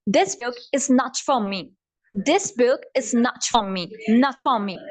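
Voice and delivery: slow British accent, hard T on "not". Shown as a demonstration. Teacher converts this book sentence, British accent. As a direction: punch the T and drop the R, British. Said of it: "British accent में हम इसको".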